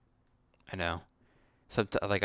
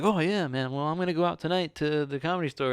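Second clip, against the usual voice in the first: much higher, loud, put-on cheerful; fake upbeat; 9 semitones higher, 6 dB louder than usual. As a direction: sell it like everything's great. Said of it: "I always try to be like".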